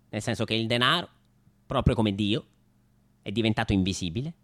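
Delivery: ordinary delivery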